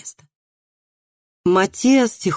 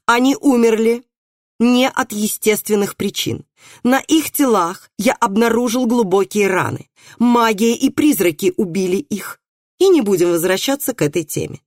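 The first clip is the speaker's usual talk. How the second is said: higher, emphatic, irritated pronouncement; angry insistence; 6 semitones higher than usual. A narrator's reading. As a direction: lay down the verdict and close the subject. Bailiff angrily shuts down the doctor.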